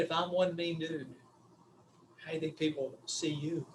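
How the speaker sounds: soft, low and gentle question; quiet, caring tone with a pause. Retold or imitated. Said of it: "I said it in a very caring way".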